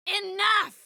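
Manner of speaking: high-pitched shout to stop; angry shout. Acted. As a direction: cut them off with a sharp cry. Cutting someone off with a shout.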